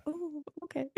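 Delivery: high, soft, teasing "ooh, okay"; impressed mock ooh. Teasing her co-host's swagger with a cooed "ooh". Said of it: "I'm like".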